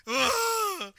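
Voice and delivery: high, loud exasperated "ugh"; hammed-up cry of frustration; tantrum groan. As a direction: groan like a child in a fit. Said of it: "throwing a temper tantrum".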